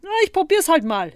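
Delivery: high, loud, breezy reply; cheerfully self-assured; confident. A narrator's reading. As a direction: toss it off with easy confidence. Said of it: "Zottel war ganz zuversichtlich".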